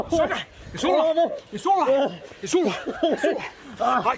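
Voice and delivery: high, loud strained soothing; loud frantic reassurance. Acted. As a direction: shout comfort while wrestling. Wrestling the pony.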